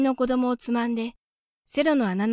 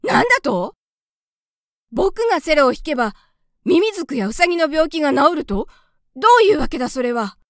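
loud, higher, astonished outburst; startled shout; 6 semitones higher, 7 dB louder than usual. A narrator's reading. Gauche cries out in amazement at the mouse. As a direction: blurt it out in disbelief. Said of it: "ゴーシュはびっくりして叫びました。".

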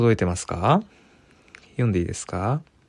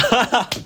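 high burst of laughter; 12 semitones higher than usual. Real, not acted. Laughs at viewer story.

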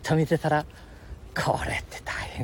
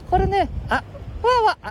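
voice cracking; 3 dB louder, slower than usual. Acted. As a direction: voice flipping into falsetto. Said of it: "声が裏返っとったろ".

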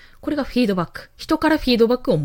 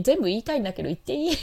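ordinary delivery

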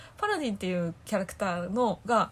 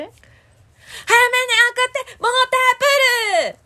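much higher imitation of Tanchan's voice; self-made Tanchan impression, pitched high; 9 dB louder, much faster than usual. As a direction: do your best guess at Tanchan, high up. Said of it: "私の想像の中でできてると思ってるモノマネのタンちゃん".

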